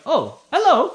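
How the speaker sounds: high-pitched gnome noticing strangers; suddenly notice you have company, pitch jumping up; acted startled hello; surprised gnome greeting. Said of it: "they start taking note of you guys".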